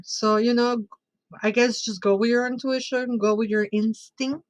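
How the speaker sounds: ordinary talk, nothing marked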